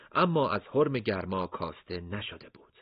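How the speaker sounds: ordinary delivery, nothing marked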